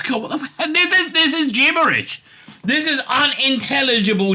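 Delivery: high, incredulous cry; disbelieving outburst. Real, not acted. Host gasping in disbelief at a gibberish article. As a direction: squeal in disbelief at the nonsense.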